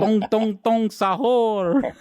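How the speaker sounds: high, drawn-out mock Italian chant; mock Italian accent. Showing co-hosts a silly meme name, mock Italian accent. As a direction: stretch it out in a hammy Italian accent. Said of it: "It's never racist to do an Italian accent".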